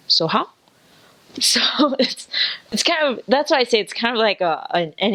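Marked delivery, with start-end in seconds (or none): 0.1-0.5 Singaporean accent. Shown as a demonstration. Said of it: "I would say".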